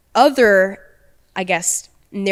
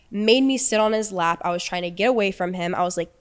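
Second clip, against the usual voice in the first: ordinary talk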